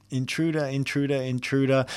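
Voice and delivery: lightly reprise a guard's warning chant; mild repeated warning cry; guard's alarm. Acted.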